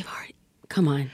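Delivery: breathy whisper, quiet; whispered awe. Real, not acted. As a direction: whisper it in amazement. Awed reaction to the quote.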